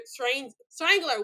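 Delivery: "Strangler" said slowly and haltingly, tripping on the start; stumbling over the word. Real, not acted. Podcaster trips on the killer's nickname, slow and halting. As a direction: drag out "Strang-, Strangler", stuck on the first syllable. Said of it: "Why is this word beating me up?".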